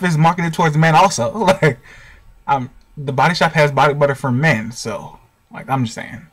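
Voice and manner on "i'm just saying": ordinary delivery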